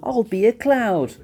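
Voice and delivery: high, hopeful little character voice; bright idea. A narrator's reading. Fluffy hits on a plan to get fluffy, eager and high. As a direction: pitch it up like a sudden idea. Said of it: "he thought".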